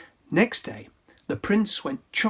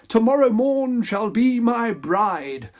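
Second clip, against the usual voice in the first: higher, louder royal proclamation; louder hammy decree; prince crying out; 5 semitones higher, 4 dB louder than usual. Acted. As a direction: announce it grandly. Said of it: "he cried".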